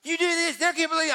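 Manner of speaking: retold angry wife scolding the crowd; angry yelling. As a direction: imitate a furious woman snapping at people. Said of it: "she was angry".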